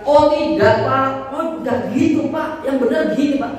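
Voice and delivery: high-pitched, insistent objection to a customer; mock contradicting. Shown as a demonstration. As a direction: cut in to correct the buyer, hammed-up. Demonstrates wrongly contradicting a complaining buyer.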